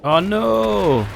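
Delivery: loud, high "oh no", swooping up and down; long, loud wail of alarm; dismayed cry. Real, not acted. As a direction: cry out in dismay at a sudden loss. His soldier is killed.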